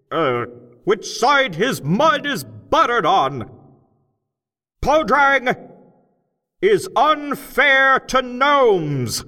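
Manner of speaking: loud, high, slow speechmaker shouting to a cavern crowd; loud, high, slow rally speech; rousing oration. A narrator's reading. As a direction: whip up the crowd of gnomes, big and deliberate. Crockett's strike speech.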